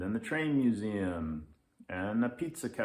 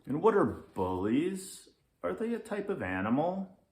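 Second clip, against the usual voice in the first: higher, innocent questioning; curious kid; 8 semitones higher than usual. Acted. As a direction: ask it wide-eyed. Child asks naive question.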